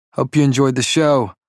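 sarcastic send-off; mocking. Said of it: "with a mock bow".

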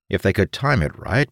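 plain reading voice

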